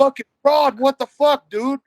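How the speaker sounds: very high, loud disbelief; comic exasperated outburst; retold outraged protest. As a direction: act out yelling at the man holding you. Retold protest at opponent in hold.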